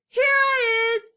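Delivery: high, long-drawn call. A narrator's reading. Invisible child answering mummy, high drawn-out call. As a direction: sing out loudly so you can be found.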